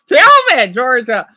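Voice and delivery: ordinary talk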